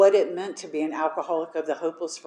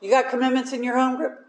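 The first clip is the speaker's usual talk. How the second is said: quick, high question; brisk questioning; 6 semitones higher, faster than usual. Retold or imitated. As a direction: rattle off the question. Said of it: "sponsor questioning".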